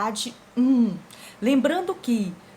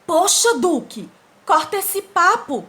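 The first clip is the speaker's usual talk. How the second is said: higher, louder, fed up, with a slow end on corta esse papo; irritated dog; 6 semitones higher, 6 dB louder, slower than usual. Acted. The annoyed dog tells Duque to stop talking, fed-up outburst. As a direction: snap at him to cut the chatter. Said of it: "fica irritado e diz assim".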